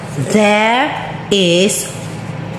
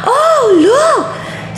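surprised gasp. Acted. Surprised discovery for child.

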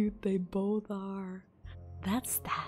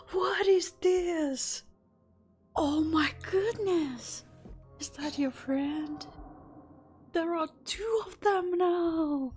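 high, loud delighted surprise; excited surprise; 9 semitones higher, 3 dB louder than usual. Real, not acted. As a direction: squeal with delight at them. Surprised by two opossums.

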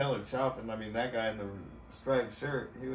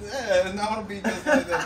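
loud, high, laughing through it; laughing excitedly; 6 semitones higher, 7 dB louder than usual. Real, not acted. Watching old men party, laughing loud and high. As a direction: laugh out the words, buzzing.

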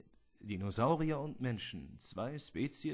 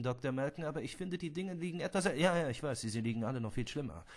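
ordinary delivery

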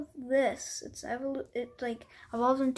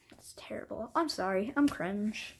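ordinary talk, nothing marked

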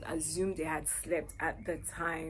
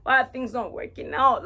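crying to God; 4 semitones higher, 7 dB louder than usual. Retold or imitated. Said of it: "crying, asking God".

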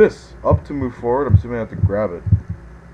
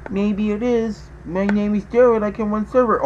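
silly high voice; 8 semitones higher than usual. Pretend. Answers as a silly character.

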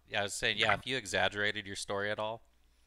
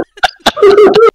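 high shrieking laugh; 30 dB louder than usual. Real, not acted. Laughing at own impression.

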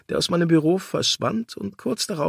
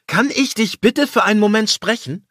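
higher, louder tense plea; shrill request; 10 semitones higher, 6 dB louder than usual. Acted. Lawyer demands a word with his partner, higher and louder. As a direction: force the request out too high. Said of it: "rief ich schriller als beabsichtigt".

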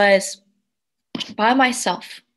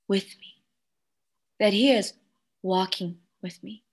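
ordinary delivery

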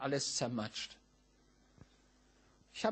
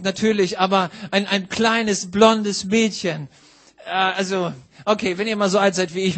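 loud, ringing pulpit voice; full-volume sermon delivery; preaching at full force; 4 semitones higher, 14 dB louder than usual. Acted. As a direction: preach it out to the whole hall. Preacher raises voice to congregation.

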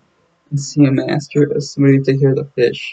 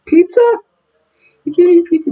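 squeaky cartoon voice. Voicing the cartoon kid about pizza, high squeaky squeal.

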